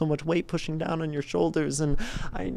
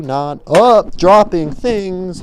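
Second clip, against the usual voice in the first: sudden loud; 7 semitones higher, 11 dB louder, much slower than usual. Acted. Eyes-closed free association.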